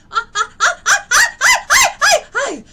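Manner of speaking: high, loud stammering, half laughing, half crying; overcome with emotion. Real, not acted. Healer overwhelmed by the energy, laughing and crying. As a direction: choke on the first word again and again. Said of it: "I don't mean to be theatrical".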